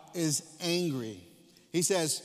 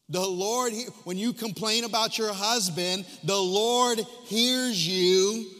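louder, slowing hard on each the Lord hears you; preaching at full force; 6 semitones higher, 6 dB louder, slower than usual. Acted. Preacher thundering at the congregation about complaining, louder and slow. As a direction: build the refrain, hammer every line.

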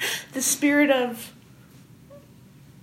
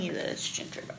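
whispering; 13 dB quieter, much faster than usual. Pretend. Whispered insistence.

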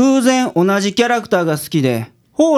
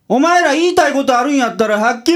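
teacher caught out by his assistants' teasing; flustered outburst; 7 semitones higher than usual. Acted. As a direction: blurt it out, embarrassed and cornered.

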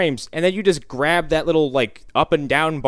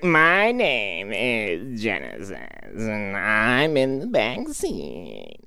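slow, soft, put-on drunk girl voice; drunk girl impression; 4 semitones lower, 4 dB quieter, much slower than usual. Mocking a drunk character from a sketch, slow and soft. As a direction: slur it out slowly like a drunk girlfriend. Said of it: "the impression he's doing, but it sounds just like this".

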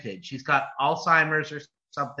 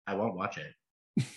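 flat refusal; 8 semitones lower, 10 dB quieter than usual. Retold or imitated. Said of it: "And I'm like".

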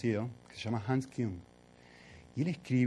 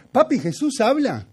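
put-on much higher and louder, a small child asking; little girl's voice; 10 semitones higher, 7 dB louder than usual. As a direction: ask it like his four-year-old daughter. Hija repite la pregunta. (voz aguda de niña pequeña que pregunta fuerte)

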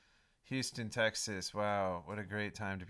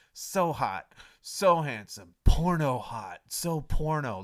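loud hammed-up swooning; loud mock swoon over a hot guy; mock gushing; 3 semitones higher, 10 dB louder, slower than usual. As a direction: gush loudly like a swooning fan. Gushing over imagined hunk.

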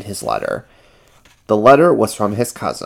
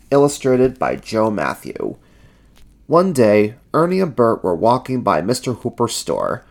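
usual speaking voice

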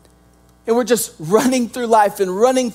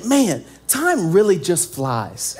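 wistful sigh; 7 semitones lower, 4 dB quieter, slower than usual. Retold or imitated. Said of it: "You start to say".

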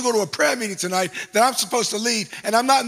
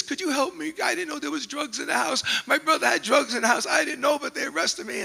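pleading; 8 semitones higher than usual. Retold or imitated. Crying handcuffed girl pleads.

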